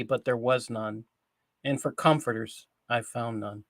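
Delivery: measured psalm, each verse falling away at its end; solemn scripture reading. Acted. Host reads the psalm of reproach, solemn scripture reading. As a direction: read the lament aloud in deliberate phrases.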